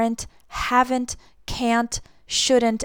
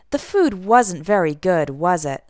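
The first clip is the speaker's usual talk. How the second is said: WAS IT drops low, not really asking; falling question tag; 3 semitones lower than usual. Shown as a demonstration. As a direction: comment expecting agreement, pitch falling on WAS IT. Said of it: "I'm not really asking the question".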